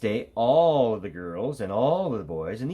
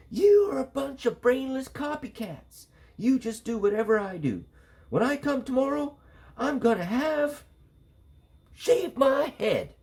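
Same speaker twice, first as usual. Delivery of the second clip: much higher, a mild mock yell; a girl scolding the copycats; angry yell; 3 dB louder than usual. A narrator's reading. Stephanie scolding classmates, high mild mock yell. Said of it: "Stephanie yelled".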